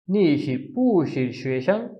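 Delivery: नी शिर पू शिर शुएशन said slowly and clearly, पू rising; measured Mandarin. Shown as a demonstration. Teacher giving the final model sentence.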